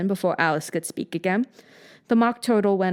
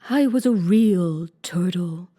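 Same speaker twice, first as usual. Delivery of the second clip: slow, sighing and heavy; deep sigh; slower than usual. A narrator's reading. Mock Turtle beginning his sad tale, slow sighing voice. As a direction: let the words sink on a sigh. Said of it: "with a deep sigh".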